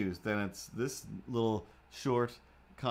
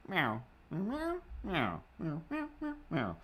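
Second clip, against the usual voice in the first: meowing imitation; 6 semitones higher, 6 dB quieter than usual. Cat answering owner.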